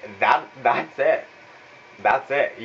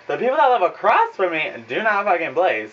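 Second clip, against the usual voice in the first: normal talking voice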